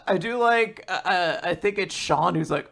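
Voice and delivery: ordinary talk, nothing marked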